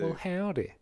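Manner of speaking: put-on Texas accent. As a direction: greet like a Texan woman. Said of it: "That sounds more like a woman".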